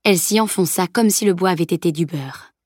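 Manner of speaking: ordinary delivery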